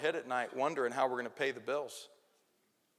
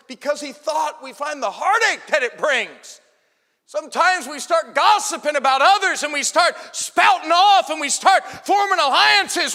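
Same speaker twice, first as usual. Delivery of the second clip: much higher, much louder shouting sermon; preaching at full force; 14 semitones higher, 17 dB louder than usual. Acted. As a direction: drive the point home with full fire. Sermon climax, shouted point.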